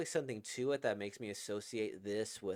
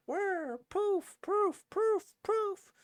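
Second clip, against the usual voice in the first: much higher, louder cartoon poofs; voiced whir and poofs; 6 dB louder, much slower than usual. Narrator voices the deletion, squeaky high poofs. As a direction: squeak out the files vanishing.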